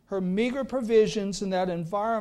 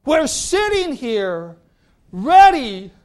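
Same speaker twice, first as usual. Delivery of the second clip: dramatic swell; 5 semitones higher, 11 dB louder, slower than usual. Retold or imitated. Dramatizing Hagar in the wilderness.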